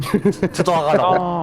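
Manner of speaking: lent, traîné, imitant un râleur; étirer les mots comme en ronchonnant; râlant traîné. Retold or imitated. Commente Romu qui râle. (ton râleur et traînant)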